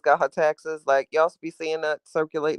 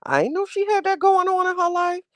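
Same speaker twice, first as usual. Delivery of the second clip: much higher acted "ooh" reaction; gossipy surprise. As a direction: react to juicy celebrity gossip. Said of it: "where I was like".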